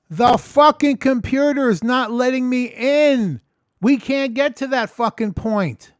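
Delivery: loud, high, exasperated rant; exasperated outburst. Retold or imitated. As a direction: hit every word at tech support in frustration. Acted exasperated reply to tech support.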